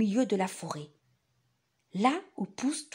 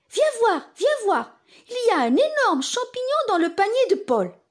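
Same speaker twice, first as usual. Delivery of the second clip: excited; 11 semitones higher, 5 dB louder than usual. Acted. Mother calls excitedly.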